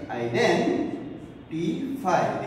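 identify drawn out slowly in parts; syllable by syllable. Shown as a demonstration. Teacher explaining a word to students, slowly syllable by syllable.

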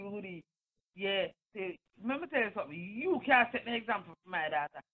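ordinary talk

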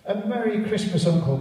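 high, loud, cheerful greeting; cheerful cry. Acted. Nephew cheerfully greeting his uncle Scrooge, high and loud. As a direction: call out a merry Christmas. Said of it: "cried the cheerful voice".

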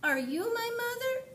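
very high hopeful question; baby bird voice. Acted. Baby bird asks the cow, very high. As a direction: squeak it up high. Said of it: "he said to the cow".

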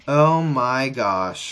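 ordinary talk

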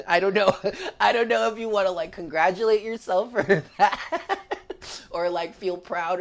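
a teary laugh breaking up the sentence. Real, not acted. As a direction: laugh it off while nearly crying. Laughing through tears at the reading.

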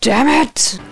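loud frustrated damn; frustrated shout. Real, not acted. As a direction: blurt it out in frustration. Frustrated outburst at locked door.

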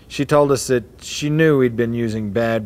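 plain reading voice

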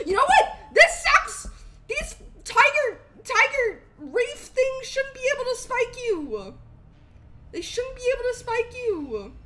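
loud, high, exasperated complaint; real gamer rage, drawn out; frustrated outburst. Real, not acted. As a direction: vent at the game. Hurt by tiger reef in game.

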